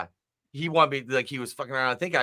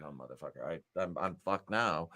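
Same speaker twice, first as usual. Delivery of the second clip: much lower and softer, muttered under breath; mock wife mutter; 7 semitones lower, 11 dB quieter than usual. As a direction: grumble it to yourself, defeated. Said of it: "She's like".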